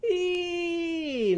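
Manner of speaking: a high, leaping "Ee!" cry; high ee squeal. Acted. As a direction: squeal it as Eve jumps. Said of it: "Eve leaping over trees".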